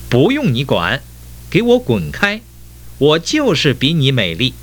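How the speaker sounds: high, indignant storybook shout without much volume; angry outburst. A narrator's reading. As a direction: lash out angrily, voice pitched high. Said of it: "生气地喊了起来". (生气地尖声喊)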